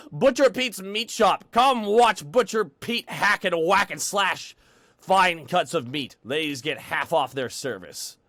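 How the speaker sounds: slow, punchy advertiser's call; ad announcer. Acted. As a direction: hawk it like a local commercial. Performs butcher shop advert.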